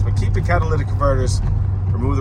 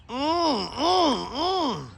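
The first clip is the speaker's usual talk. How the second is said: appreciative hums; 12 semitones higher, 3 dB quieter, much slower than usual. Real, not acted. Savoring the look of the car.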